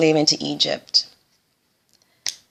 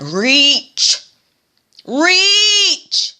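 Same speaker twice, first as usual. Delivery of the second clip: high, loud straining cry of reach; desperate cry; 11 semitones higher, 6 dB louder than usual. Acted. Samwise reaching for Frodo's hand, desperate high cry. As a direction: stretch the word out as a desperate shout. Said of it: "Put out his hand".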